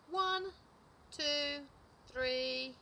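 high, loud, drawn-out counting; exaggerated counting. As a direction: count out each number deliberately, ringing and separate. Squirrel starts counting over.

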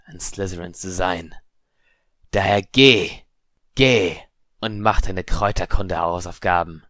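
emphatic scolding, building to a loud command; condemn, then loudly order them away; stern unseen voice. A narrator's reading. Hidden voice dismissing a student, stern and commanding.

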